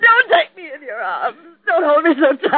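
weeping wife begging her husband to let go; crying. Acted. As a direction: push him away while weeping. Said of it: "Lorraine had her cry out".

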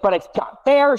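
high whiny kid protest, imitated; imitating whiny child. Dad voicing daughter protesting unfairness, high whine. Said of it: "And she's like".

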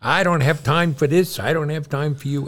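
a doctor snapping he has no time; retold yelling. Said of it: "He started yelling at me".

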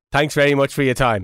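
low reporter sign-off; TV interviewer sign-off. Acted. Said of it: "you do the fifa thing at the end".

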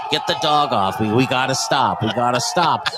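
low, restrained plea to stop; imitated frozen man. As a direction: lightly voice a man frozen stiff. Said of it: "he's frozen, and he's like".

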